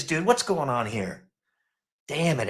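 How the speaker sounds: normal talking voice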